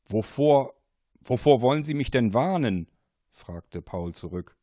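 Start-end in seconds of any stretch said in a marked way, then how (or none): 0.1-2.9 louder, higher startled question; alarmed question. Acted. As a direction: demand what the danger is. Paul alarmed by warning.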